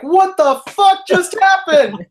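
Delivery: high, loud, drawn-out yell. Retold or imitated. Worker yelling at the back of concession, shocked outburst. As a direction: yell it slowly in outraged disbelief. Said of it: "started yelling".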